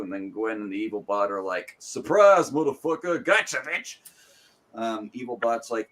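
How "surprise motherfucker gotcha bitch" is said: loud, high villainous reveal; spring the trap with glee; hammed-up ambush cry; mock triumphant gotcha.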